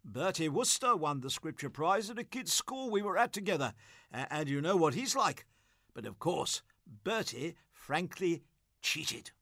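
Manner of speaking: a hint of an over-cheery, tipsy prize-giver; chummy and a touch sloshed, lightly played; lightly voiced tipsy Gussie. Tipsy Gussie chats to a prize-winning boy, lightly voiced.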